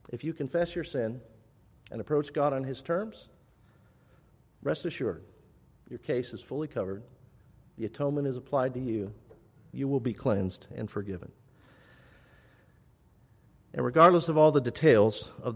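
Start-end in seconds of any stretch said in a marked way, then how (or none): 0.1-11.3 softly, calmly reassuring; gentle reassurance. Real, not acted. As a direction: drop to a soft voice and comfort them. Preacher reassures the repentant congregation.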